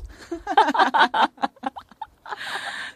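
high giggling laugh; real giggle. Real, not acted. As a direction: burst out giggling. Laughing after her performance.

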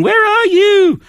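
put-on very high childlike squeak; little granddaughter voice. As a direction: play the little girl calling. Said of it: "he was asking".

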